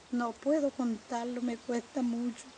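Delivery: fighting back tears as she speaks; tearful, barely holding together; voice breaking. Real, not acted.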